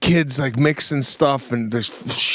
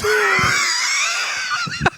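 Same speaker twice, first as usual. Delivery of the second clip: high shrieking laugh. Real, not acted. Laughing at the cake story, high shriek of laughter. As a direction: burst into a high squealing laugh.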